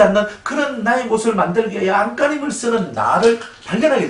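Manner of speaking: 목소리를 높여 힘주어 외치는 설교; 청중을 다그치듯 열띠게 설교하기. Acted. Preaching at full force. (회중에게 열정적으로 설교하는 목사처럼 힘차게)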